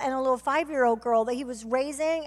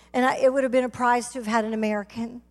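ordinary delivery

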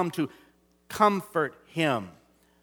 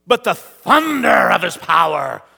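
big booming louder line; thunder; 5 dB louder than usual. Acted. Preacher booms God's thunder to the congregation. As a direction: let the thunder roll out. Contrasting thunder after whisper.

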